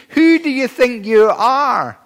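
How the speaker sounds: high-pitched mock challenge; retold indignant shout. Preacher re-quoting the crowd's challenge to Jesus, mock-indignant cry. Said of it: "they shouted, they screamed, they shrieked".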